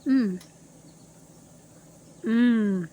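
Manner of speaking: savoring hum. Real, not acted. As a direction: hum with delight at the taste. Tasting the pie.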